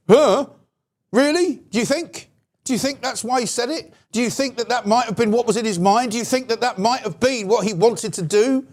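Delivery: high, mock-amazed questions, dripping with sarcasm; sarcastic disbelief. Sarcastic feigned amazement at commentator.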